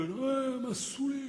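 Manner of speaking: imitating a rough morning-after voice; slightly hoarse voice. Husband imitating friends after a night of rows, hoarse. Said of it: "ils ont la voix rauque un peu".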